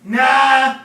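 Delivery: huge roaring no; booming shout. Acted. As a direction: bellow it with full force. God shouts refusal.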